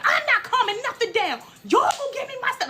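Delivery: high defiant screech; hammed-up, high-pitched refusal; mock screaming. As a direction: imitate a guest yelling over the staff. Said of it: "as she's screaming".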